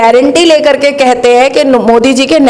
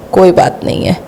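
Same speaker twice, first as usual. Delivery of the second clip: sarcastic dismissal; 6 semitones lower than usual. Retold or imitated. Sarcastic refrain after each charge.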